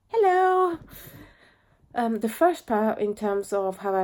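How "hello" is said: high, loud playful greeting; loud cheery hello. Real, not acted. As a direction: burst out a silly hello. Sudden greeting to camera.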